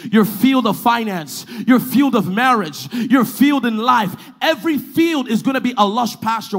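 loud, rhythmic declarations building in force; full-force preaching. Real, not acted.

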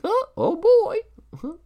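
tiny squeaky high voice, slow; drawn-out squeaky wonder; little kid awe. Acted. Tiny Billy squeezed next to fat guy, squeaky slow awe. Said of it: "so he's just like".

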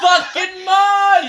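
high, drawn-out yell; exclaiming shout. Real, not acted. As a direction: shout the name out, stretched and high. Exclaims at friend's name coming up.

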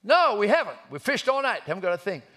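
loud, high holler of irritation; yelling back. Acted. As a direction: shout a grumpy answer back across the water. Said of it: "Peter yells back".

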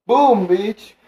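high, loud triumphant jab; cocky victory shout; gloating. Real, not acted. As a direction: spike it like a winning point. Gloating after winning argument.

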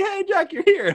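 high, loud mock greeting; surprised greeting. Sister's class greeting Jack as he walks in, surprised. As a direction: greet him with delighted surprise.

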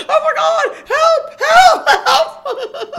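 high, loud screaming for help, half laughing; panicked cries for help. Retold or imitated. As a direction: shriek for help under the cougar. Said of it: "and I was going".

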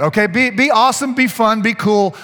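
ordinary talk, nothing marked

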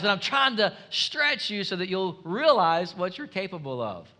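ordinary talk

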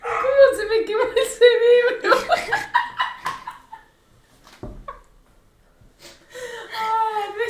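real laughing fit, barely holding it together; helpless laughter. Real, not acted. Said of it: "¿Qué te tentaste?".

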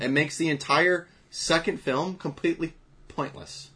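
loud, high exasperated emphasis on entire; indignant rant. Real, not acted. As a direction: hammer home how pointless it was. Ranting about Alien three plot.

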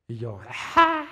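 a loud, sudden retold wail; a father reliving his loud sob; crying wail. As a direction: let out one loud cry of grief.

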